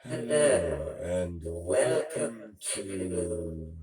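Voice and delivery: slow, loud host announcement; grand slow intro like a TV host; announcer. Acted.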